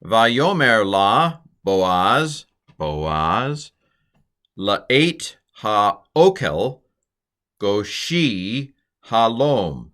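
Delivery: slow, careful Hebrew reading. Shown as a demonstration. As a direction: sound out each Hebrew word slowly and clearly. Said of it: "Here's my pronunciation".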